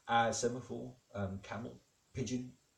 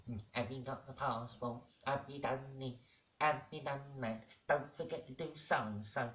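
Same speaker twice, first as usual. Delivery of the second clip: higher, softer nagging wife voice; 4 semitones higher, 5 dB quieter than usual. Acted. Wife to husband before the holiday, nagging. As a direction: pester him with one reminder after another. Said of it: "she's always nagging at him".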